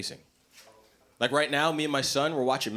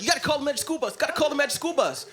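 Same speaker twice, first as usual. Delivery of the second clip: put-on high, eager child voice; high-pitched chanting kid caller; kids calling in; 12 semitones higher, faster than usual.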